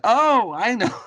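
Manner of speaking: high, loud, eureka moment; sudden realization. Retold or imitated. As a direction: blurt it out in a flash. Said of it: "And I'm like".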